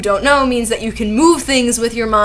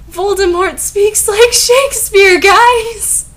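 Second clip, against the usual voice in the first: very high, loud, gushing disbelief; mock amazement; 11 semitones higher, 6 dB louder, slower than usual. Reader telling listeners about Voldemort's Shakespeare talk, mock-amazed. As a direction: announce it in overblown fake excitement.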